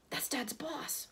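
hushed, breathy aside; breathed in awe. A narrator's reading. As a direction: breathe it out under your breath. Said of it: "Ron breathed".

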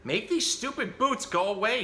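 hammed-up cross little miss; bossy shout. A narrator's reading. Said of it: "shouted little Miss Bossy".

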